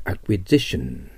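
acquisition with the stress on the "si" syllable; teacher showing the stress in acquisition; stress shifted demo. Shown as a demonstration. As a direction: model acquisition slowly for students. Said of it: "アクセント変わります".

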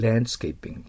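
landscaping with the d nearly silent; English pronunciation. Shown as a demonstration. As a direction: say landscaping as "lanscaping". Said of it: "Dはほとんど聞こえなくなる".

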